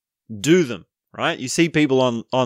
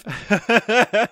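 high burst of laughter; 11 semitones higher than usual. Real, not acted. Laughing at the clone answer.

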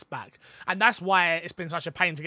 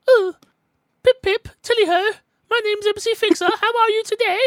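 very high, loud posh British caricature; posh British accent; 7 dB louder than usual. Acted. Host showing off his usual posh act, high-pitched British accent. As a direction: sing out a hammed-up posh British greeting. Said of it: "is that my posh? No, I usually go with".

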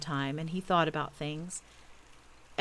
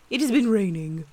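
loud, weighty owl explanation; learned owl voice; pompous Owl; 3 semitones higher, 6 dB louder than usual. A narrator's reading. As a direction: explain it with great self-importance. Owl explaining to Christopher Robin.

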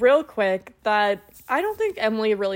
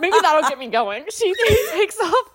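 a giggle under the quoted words; half-laughing while retelling the line; laughing through it; 8 semitones higher than usual. Retold or imitated. Retelling Hannah's shirt line to co-host: laughing through it.

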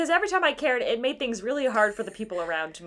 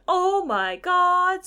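shocked gasp; 8 semitones higher, 4 dB louder, much slower than usual. Retold or imitated. Shocked reaction to the truck.

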